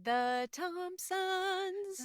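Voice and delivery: slow stretched name; drawn-out quote. Retold or imitated. Cohosts savoring the name, stretched out slowly.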